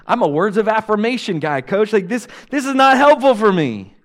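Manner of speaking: loud, high, exasperated pleading; hammed-up complaint, rising to a shout; comic protest. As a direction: plead with the coach in mock indignation. Acted protest to the coach.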